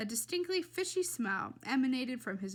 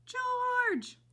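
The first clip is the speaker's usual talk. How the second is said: high, loud drawn-out cry; screamed name; 14 semitones higher, 5 dB louder than usual. A narrator's reading. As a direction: scream for your husband. Mother screaming for her husband.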